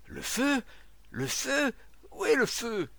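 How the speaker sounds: high, urgent questioning cries; startled, high-pitched fire alarm; alarmed shouting. Acted. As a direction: call out in rising alarm. Crowd alarmed about a fire.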